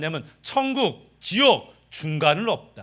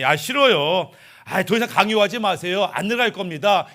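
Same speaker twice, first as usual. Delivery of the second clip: louder, irritated no; annoyed refusal; 4 semitones higher, 5 dB louder than usual. Acted. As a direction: push Jesus away, irritated. (짜증 섞인 큰 거절)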